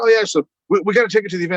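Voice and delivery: high-pitched easy-going reply; mock casual. Telling Phoebe the cake must go to the event, high and casual. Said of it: "And I was like".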